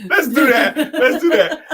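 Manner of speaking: high, laughing let's do that; excited laughing repeat. Real, not acted. Husband cheering his wife's joke idea, laughing and high. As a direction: bubble over with the idea.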